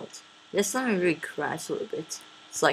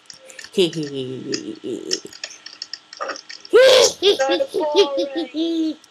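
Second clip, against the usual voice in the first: witch cackle; 8 semitones higher, 5 dB louder than usual. Acted. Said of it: "I'ma sound like a witch".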